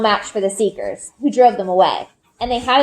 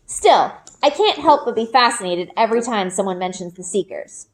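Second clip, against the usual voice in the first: ordinary delivery, nothing marked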